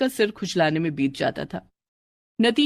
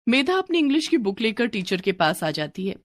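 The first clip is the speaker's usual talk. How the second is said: ordinary delivery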